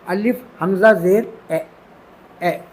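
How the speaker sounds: alif hamza zer spelt out, ए with a small jolt; ए with a glottal catch, like reading Arabic; demo glottal e. Shown as a demonstration. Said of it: "थोड़ा झटका पैदा हो जाएगा".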